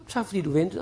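soft, gentle thank-you; warm thanks. Shown as a demonstration. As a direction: thank them softly and kindly. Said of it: "det her magiske ord".

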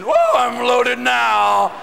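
slow, drawn-out stoned whoa; dazed slow-motion brag; stoned drawl. Acted.

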